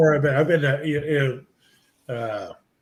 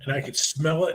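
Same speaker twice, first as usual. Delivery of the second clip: normal talking voice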